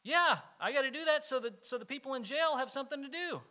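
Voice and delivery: hammed-up voice of a man sure of his silly reason; the litterer. Retelling the litterer's justification to a youth group, mock voice. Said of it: "And he's like".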